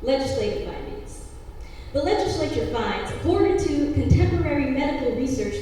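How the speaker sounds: loud, stiff bill recitation; formal legal reading. Acted. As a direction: read it like a statute. Reads bill text in poem.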